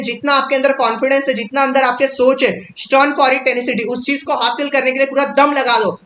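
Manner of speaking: rousing motivational coach in full flow; motivational speech. Acted. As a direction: fire them up with punchy, urgent commands. Fiery motivational poem recital and explanation.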